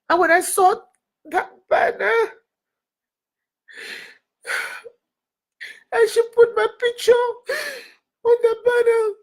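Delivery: sobbing, voice pitched high; real crying. Real, not acted. Speaker telling listeners her picture was on the banner, crying. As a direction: break down in tears while talking. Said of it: "why I'm crying over that banner".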